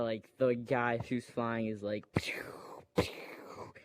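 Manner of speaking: breathy whispered blaster noise; soft mock laser shots; whispered pew pew. Kid imitates blaster sound.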